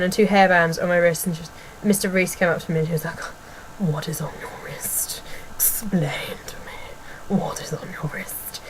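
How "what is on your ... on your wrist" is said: slow, whispered questioning; imitated teacher interrogation. Imitating teacher questioning pupil about wristbands, slow hushed whisper. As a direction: whisper each demand slowly and very softly. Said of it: "exactly like that".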